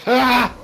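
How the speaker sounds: sudden high laughing shriek; laughing scream. Real, not acted. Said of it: "I don't know why you screamed at that".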